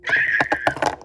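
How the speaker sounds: very high, loud shriek; short scream. Pretend. Child reacting to a warning, high scream. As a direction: let out a piercing yelp.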